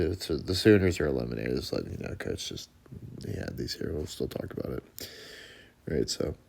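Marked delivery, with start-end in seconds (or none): 1.6-5.1 lower, softer half-whisper; whispered aside. Real, not acted. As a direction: drop into a confiding aside. Aside about the eliminated Sooners.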